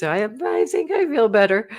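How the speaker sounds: hesitant, stammering, doubtful claim; unsure. Shown as a demonstration. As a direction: sound like you don't believe it. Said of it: "Like this".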